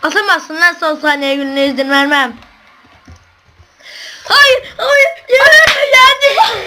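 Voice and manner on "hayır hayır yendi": high, loud despairing cry; anguished shout. Real, not acted. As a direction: wail it as the game is lost. Losing last-second match.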